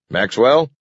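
loud rising call; shouting. A narrator's reading. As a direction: call out over the radio. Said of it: "he shouted".

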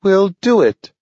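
a little dog making a solemn promise; pledging dog. A narrator's reading. Dog Nathaniel pledging to Grant, high and earnest. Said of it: "Nathaniel pledged".

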